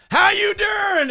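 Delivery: very high, loud yelled character greeting; Bud Britches voice. Retold or imitated. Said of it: "and I'd go".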